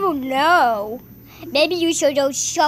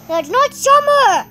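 indignant yell; 7 semitones higher, 5 dB louder than usual. Real, not acted. Arguing, higher and louder.

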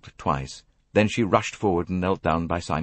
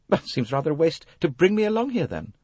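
put-on higher-pitched, huffy young woman; ungracious; 11 semitones higher than usual. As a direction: complain at being dragged along. Ungracious girl retorts to Poirot.

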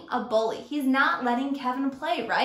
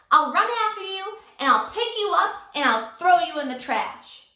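higher, louder threat; bully hollering; 4 semitones higher, 5 dB louder than usual. Acted. Teacher as Sammy, hollering threats at Kevin. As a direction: rattle off the threat. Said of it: "Sammy hollered".

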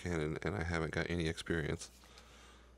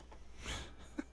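breathy disbelieving laugh; 9 dB quieter than usual. Real, not acted. Disbelieving laugh at bad luck.

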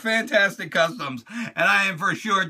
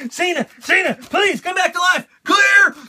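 high, loud mock pleading; pleading shout; 11 semitones higher, 6 dB louder than usual. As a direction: shout it like a frantic medic. Mock reviving the figure.

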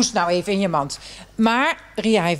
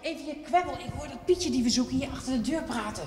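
hushed; 3 semitones higher, 10 dB quieter than usual. Acted. Listening at the door.

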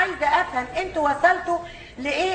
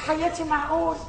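ordinary talk, nothing marked

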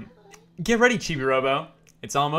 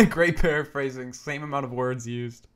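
ordinary delivery

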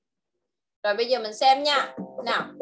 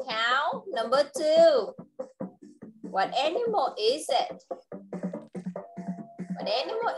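put-on reading to a toddler. Teacher's sing-song game voice.

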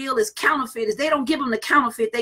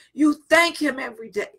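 ordinary talk

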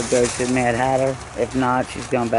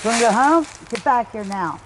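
scolding; 6 semitones higher, 4 dB louder than usual. Real, not acted. Scolding dog from afar.

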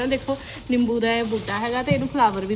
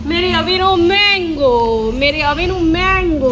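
doting sing-song; 4 semitones higher, 8 dB louder, slower than usual. Real, not acted. Doting mother names son's favourite.

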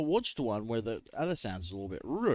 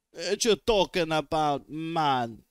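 badly faked Jamaican accent, a bit louder; 4 semitones higher, 5 dB louder, slower than usual. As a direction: toss off a clumsy Jamaican accent. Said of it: "I do a horrible Jamaican accent".

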